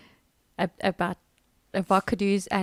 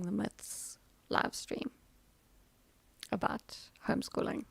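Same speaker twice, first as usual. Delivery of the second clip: whisper; 8 dB quieter than usual. Real, not acted. Trailing aside.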